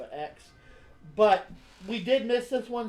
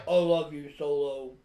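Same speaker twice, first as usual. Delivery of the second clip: deep monotone; 6 semitones lower than usual. Retold or imitated. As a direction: lower and dragging, no life. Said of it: "he dropped, like, several octaves".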